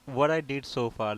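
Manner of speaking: ordinary talk, nothing marked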